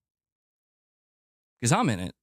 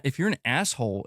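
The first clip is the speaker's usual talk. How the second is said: ordinary delivery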